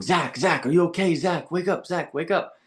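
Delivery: imitating a worried dad trying to rouse his son; alarmed dad. Dad calling to unconscious son.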